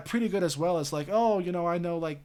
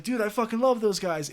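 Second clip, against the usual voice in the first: excited fan; 7 semitones higher, 4 dB louder than usual. Retold or imitated. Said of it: "I was like".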